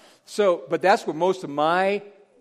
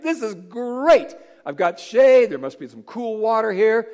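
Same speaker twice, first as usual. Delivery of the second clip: pleased, higher and louder; content Jonah; 7 semitones higher, 7 dB louder than usual. Retold or imitated. Jonah resting under the plant, delighted and smug. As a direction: savour the cool shade. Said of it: "He's like".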